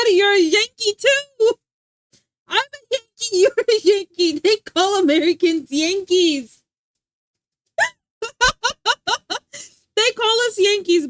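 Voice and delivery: high, loud, laughing tease; excited teasing. Real, not acted. Streamer gleefully tells her pet he is a Yankee.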